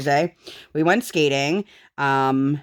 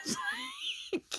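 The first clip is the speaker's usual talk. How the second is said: laughing; 10 dB quieter, faster than usual. Real, not acted. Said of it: "laughs while telling".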